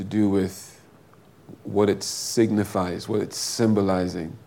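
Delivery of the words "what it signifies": normal talking voice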